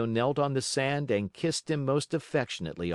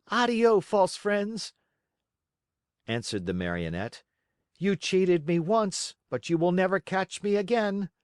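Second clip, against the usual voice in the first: high, firm dismissive farewell; scornful puppet; 10 semitones higher, 3 dB louder than usual. Acted. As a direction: turn them away with scorn. Pinocchio rebuffs beggars.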